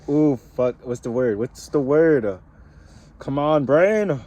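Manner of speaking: urging his own brain on; frantic word search. Real, not acted.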